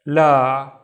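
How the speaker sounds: la with a loud, drawn-out aa; long vowel. Shown as a demonstration. Teacher reading a new letter shape, la held long. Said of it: "along la".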